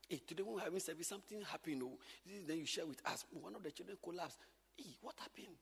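hushed gossip. Acted. Acting teachers sharing news.

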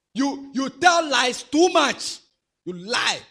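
loud slow rebuke of a liar; scolding rebuke. Acted. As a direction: drag out each word to shame him. Scolding a child in church.

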